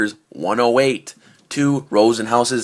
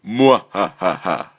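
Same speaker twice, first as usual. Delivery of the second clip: mad scientist; slower than usual. Pretend. Sign-off evil laugh.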